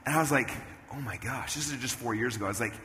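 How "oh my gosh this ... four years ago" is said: low, soft disbelief; muted retold horror; quiet dismay. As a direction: say it half to yourself, appalled. Retold dismay at old videos.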